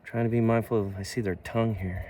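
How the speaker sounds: ordinary talk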